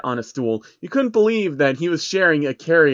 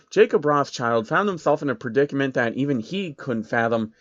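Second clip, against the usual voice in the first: ordinary delivery, nothing marked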